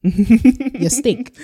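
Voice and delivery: high, loud playful jeer; mock insult. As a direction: taunt them playfully.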